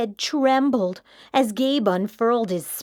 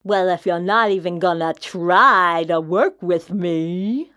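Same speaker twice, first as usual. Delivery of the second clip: whined; 6 semitones lower, 4 dB louder than usual. A narrator's reading. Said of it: "Mac whined".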